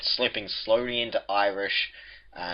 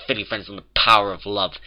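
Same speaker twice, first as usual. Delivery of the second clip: ordinary delivery, nothing marked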